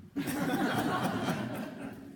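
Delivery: breathy rising laugh; airy high laugh. Real, not acted. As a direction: laugh lightly at your own joke. Laughs at own remark.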